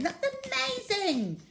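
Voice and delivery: high, thrilled squeal of delight; amazed excitement. Acted. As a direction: gasp it out, dazzled by the rat. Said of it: "wildly applauding".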